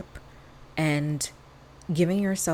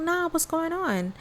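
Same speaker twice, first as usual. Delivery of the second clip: much higher, bright surprised question; hearing a friend's apology, higher and surprised; 11 semitones higher than usual. Retold or imitated. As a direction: pop up high in surprise. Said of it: "And I was like".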